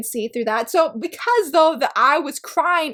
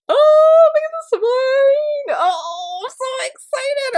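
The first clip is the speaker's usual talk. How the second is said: high, gushing squeal over a new book; real excitement; 11 semitones higher, 5 dB louder, slower than usual. Real, not acted. Vlogger admiring her new manga volume, squealing excited. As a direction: squeal it, stretching the words out.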